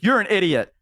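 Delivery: mock exasperated outburst; retold scolding. Elf kicking the door open on her injured friend, mock scolding.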